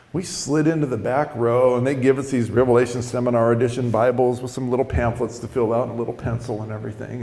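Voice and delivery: usual speaking voice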